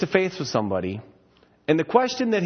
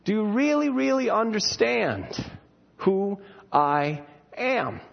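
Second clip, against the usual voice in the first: earnest question; 6 semitones higher, slower than usual. Retold or imitated. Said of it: "The test is".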